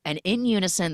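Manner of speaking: ordinary talk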